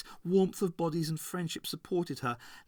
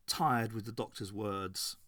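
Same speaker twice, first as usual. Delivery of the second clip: plain reading voice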